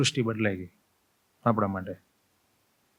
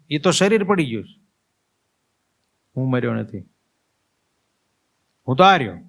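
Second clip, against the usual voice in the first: higher, louder desperate calls; acted yell; 4 semitones higher, 3 dB louder than usual. Dead man yelling at his family, higher and louder. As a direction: shout to be heard by the living. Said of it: "મેં રાડ પાડી".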